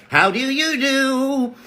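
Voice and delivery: high slow put-on greeting; character voice. Greeting a fan in a put-on voice. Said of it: "character greeting voice".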